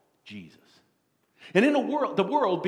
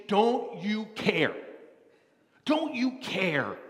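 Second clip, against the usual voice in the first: accusing; 3 semitones higher, much slower than usual. Acted. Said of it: "You see the accusation?".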